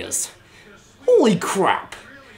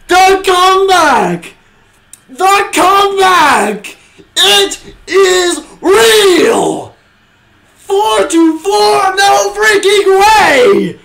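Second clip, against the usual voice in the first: slow, stretched-out shouting, much higher and louder; drawn-out joyful yell; 10 semitones higher, 5 dB louder, much slower than usual. Real, not acted. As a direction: savor every word, still yelling in disbelief. Celebrating the tying goal.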